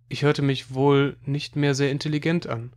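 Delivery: ordinary delivery, nothing marked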